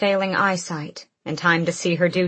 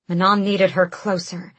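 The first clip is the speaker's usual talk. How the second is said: plain reading voice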